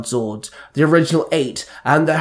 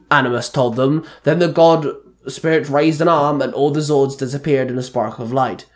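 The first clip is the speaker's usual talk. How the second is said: ordinary delivery, nothing marked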